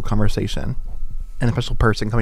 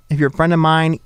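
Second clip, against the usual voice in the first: normal talking voice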